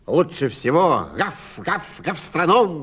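loud puppy voice barking out the words. Acted. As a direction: bark it like an eager puppy. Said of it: "сказал щенок тявка". (громкий щенячий лай)

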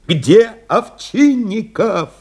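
high, stretched-out shout; крик в трубку. A narrator's reading. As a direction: demand it urgently, dragging out each word. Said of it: "закричал Новиков". (протяжный высокий крик командира)

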